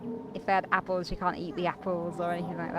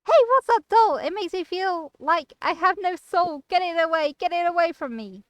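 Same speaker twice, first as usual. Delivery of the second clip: high, loud terrified pleading; panicked goblin; 10 semitones higher, 9 dB louder than usual. Acted. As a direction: shriek it out in sheer dread. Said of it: "twisting into a mask of sheer dread".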